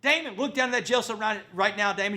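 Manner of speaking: imitating a mother screaming orders, high and loud. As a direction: scream it like a football coach. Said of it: "She's screaming and she said".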